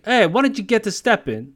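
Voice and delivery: high mock brush-off; dismissive. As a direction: shoo her away with attitude. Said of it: "He's like".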